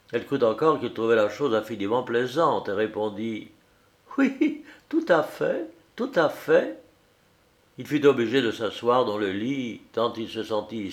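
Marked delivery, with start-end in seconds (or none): 4.2-6.8 cheerful wife. A narrator's reading. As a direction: sing it out lightly, amused. Wife cheerfully confirms, thinking it funny.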